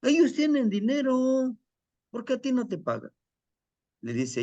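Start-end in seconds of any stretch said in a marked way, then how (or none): 0.0-3.1 higher voiced, fast and reproachful; resentful son. Son reproaches his father for not getting paid, high and fast. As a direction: fire the question at the father. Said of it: "son arguing with his father".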